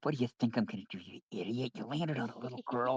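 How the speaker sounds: low, under-the-breath grumble; grumbling softly in character; muttering to himself. Acted. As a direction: mutter a scolding, half to yourself. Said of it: "Peregrine's muttering to himself".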